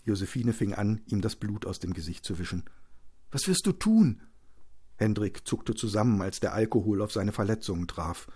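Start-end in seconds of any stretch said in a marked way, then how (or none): 3.3-4.2 high-pitched voice of the wife; worried wife. Acted. Wife tending her husband's wounds, worried. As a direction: ask it high and anxiously.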